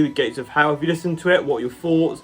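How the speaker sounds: normal talking voice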